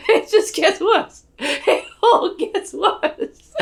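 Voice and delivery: high, loud, drawn-out cackling words; acted manic laughter, stretching the words; hysterical laughing. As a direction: laugh through it as if everything is collapsing. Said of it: "I was like".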